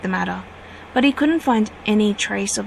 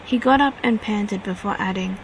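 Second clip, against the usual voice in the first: plain reading voice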